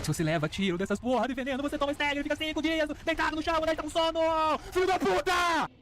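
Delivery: high-pitched angry rant; raging gamer. Real, not acted. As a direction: rant it out, furious. Ranting about poison stagger.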